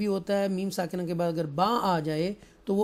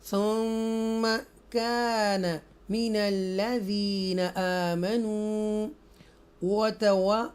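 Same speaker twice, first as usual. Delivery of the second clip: slow, drawn-out tajweed chant; Quran recitation; 2 semitones higher, much slower than usual. Shown as a demonstration. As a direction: recite each word slowly and melodically.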